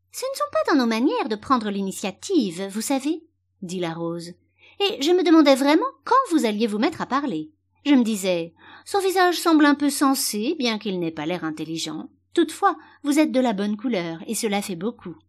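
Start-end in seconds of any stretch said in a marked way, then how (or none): 0.1-3.2 higher-pitched, prim flower character; lofty lecture on good manners; haughty Rose. Acted. Voices the Rose. 4.8-15.2 higher, louder opening, easing down as she appraises; condescending flower lady; haughty Rose. Acted. Voices the Rose.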